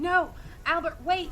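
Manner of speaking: high, loud desperate shout; calling out. Acted. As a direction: yell after him to stop.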